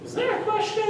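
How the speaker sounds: put-on high, small, innocent kid asking; high kid voice, soft and innocent; mock kid voice. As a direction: play the eager kid asking sweetly.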